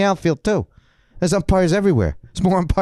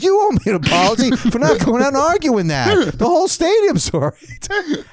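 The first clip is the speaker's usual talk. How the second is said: retold outrage; 11 semitones higher than usual. Seven-year-old rants at his coach, high indignant outburst. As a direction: wail it like a wronged child. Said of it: "And I said".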